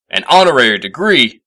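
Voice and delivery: scoffing in disbelief; indignant exclaim. A narrator's reading. Professor scorns an honorary degree, loud indignant scoff. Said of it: "he had exclaimed".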